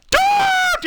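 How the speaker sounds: high shrieking yell of don't; sudden high scream; shrieked don't. Retold or imitated. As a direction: shriek it like a startled woman. Customer quoted yelling.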